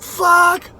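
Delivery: high yelped curse of pain. Retold or imitated. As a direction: cry out the curse as the bite lands. Snake bites his hand.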